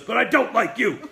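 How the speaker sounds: loud, high, angry accusation; Burt Reynolds snarling. Retold or imitated. Star singles out the director.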